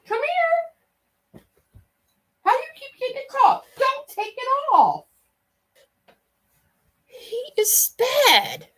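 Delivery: high kitty-kitty calling voice; pet-calling voice. Real, not acted. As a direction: coax a kitty to come over. Coaxing the cat in pet voice.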